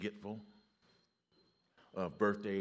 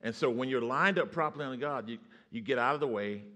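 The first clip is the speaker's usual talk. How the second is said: louder, rising preacher's push; preaching; 5 semitones higher, 8 dB louder than usual. Real, not acted. Preacher exhorting the church, loud and rising. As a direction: drive it home to the congregation.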